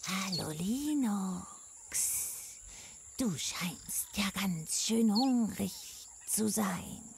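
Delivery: soft, hissing sly snake voice. Acted. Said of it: "Kichernd und zischend".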